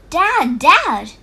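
loud call after someone running off. A narrator's reading. Tom calling after his fleeing dad, loud. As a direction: call out to Dad as he runs away. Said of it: "Tom called".

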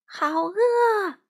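high, loud hungry yell; shouting. Acted. Crow yells to mom that he is hungry, high and loud. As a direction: call out across the yard. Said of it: "花袜子冲她大喊道".

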